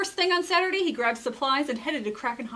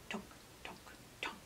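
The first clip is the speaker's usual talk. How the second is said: soft tunk sounds; 19 dB quieter, faster than usual. A narrator's reading. Sound of creeping carrots.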